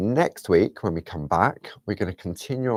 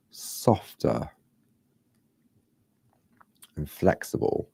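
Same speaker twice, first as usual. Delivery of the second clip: trailing into a whisper; 3 dB quieter, slower than usual. Real, not acted. Explaining parchment feel, drifting into whisper. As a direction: let it fade to a whisper. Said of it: "I don't know why I'm whispering".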